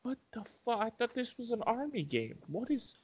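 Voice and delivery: high, hushed mock disbelief; baffled gamer. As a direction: act stunned, confused by the game's twist. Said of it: "and you're like".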